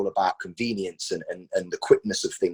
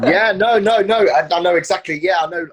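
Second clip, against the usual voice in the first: laughing; 9 semitones higher, 9 dB louder than usual. Real, not acted. Laughing at a friend's rambling.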